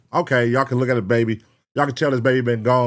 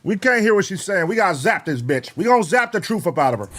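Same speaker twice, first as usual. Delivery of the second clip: higher-pitched mock police order; mock urgent command; 8 semitones higher than usual. Reactor jokingly calls for the taser at the crying mother, mock urgent. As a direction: play-act an exasperated call for backup.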